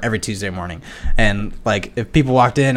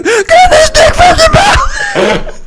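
squealing high shout, laughing through it; hammed-up insult yelled down the phone; mock angry yell; 7 dB louder, much slower than usual. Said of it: "He's like".

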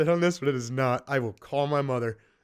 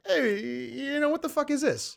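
much higher, mock Italian complaint; Italian accent; 12 semitones higher than usual. Diner complaining about the wrong order, mock Italian accent. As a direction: put on a high-pitched Italian protest. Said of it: "why'd you get Italian?".

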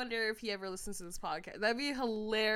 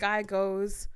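ordinary talk

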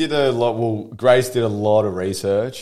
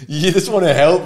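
ordinary talk

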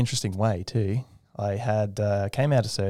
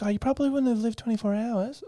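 very high, happy imitation; chipper surgeon. Surgeon explains patient's odds, high chipper imitation. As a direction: deliver grim news like cheerful small talk. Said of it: "she is a very chipper woman, very happy".

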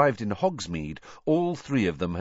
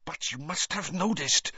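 much higher, anxious pleading; agitated; 10 semitones higher than usual. Acted. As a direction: press the point anxiously. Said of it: "in an agitated voice".

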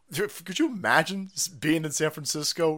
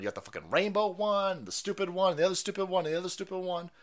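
ordinary talk, nothing marked

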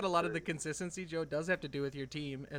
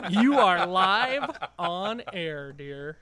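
radio host voice; 9 dB louder, much slower than usual. Acted. Mock radio host prank call.

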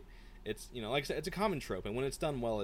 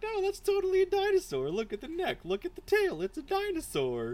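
much higher, louder put-on girl's voice; insistent lab assistant; 6 dB louder than usual. Retelling the scene, she voices the assistant insisting, much higher. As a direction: squeak out the obvious, exasperated and certain.

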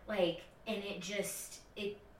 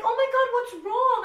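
high, loud alarmed voice; worried family; 11 dB louder than usual. Retold or imitated. Sister on the call spotting her tears, high and alarmed. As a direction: sound suddenly alarmed. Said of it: "And they're like".

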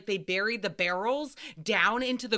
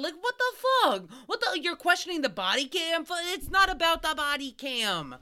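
outraged rant; 6 semitones higher than usual. Retold or imitated. Venting at her brother for interrupting her story, outraged and high-pitched. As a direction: tear into the know-it-all brother.

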